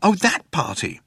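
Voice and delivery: high-pitched, caught-out recognition; guilty realisation. A narrator's reading. Zaphod suddenly remembers the party, guiltily. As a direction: remember it all too well. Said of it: "with a guilty start".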